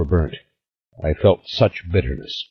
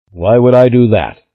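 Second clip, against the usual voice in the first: louder, insistent question; an old man demanding, a bit louder; 5 semitones higher, 5 dB louder than usual. A narrator's reading. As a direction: demand an answer. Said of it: "I demanded".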